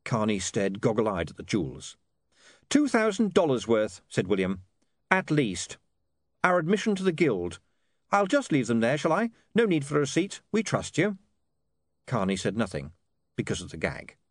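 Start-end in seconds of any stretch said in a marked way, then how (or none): 2.7-4.0 high, matter-of-fact character voice; brisk young negotiator. Acted. Voices William bargaining. 5.1-11.2 high, matter-of-fact character voice; brisk young negotiator. Acted. Voices William closing the deal.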